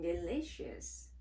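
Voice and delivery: usual speaking voice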